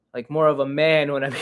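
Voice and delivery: ordinary talk, nothing marked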